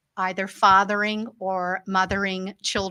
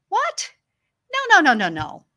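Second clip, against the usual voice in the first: high, sharp What then quick protesting nos; shocked disbelief; 11 semitones higher, 5 dB louder than usual. Retold or imitated. Mom reacting to daughter calling herself ugly, shocked. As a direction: jump up in pitch, refuse to accept it.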